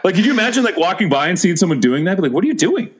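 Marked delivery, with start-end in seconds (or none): 2.3-2.9 high-pitched bewildered onlooker; playful incredulous question; mock bafflement. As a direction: act the puzzled passer-by, pitch raised. Passer-by seeing grave talk.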